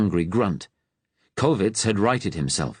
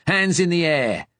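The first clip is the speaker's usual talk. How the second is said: fierce Cockney; 8 semitones higher than usual. A narrator's reading. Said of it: "his accent suddenly broad Cockney".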